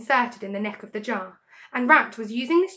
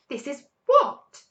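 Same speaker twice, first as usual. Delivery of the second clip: puzzled Badger; 4 semitones higher, 6 dB louder, slower than usual. A narrator's reading.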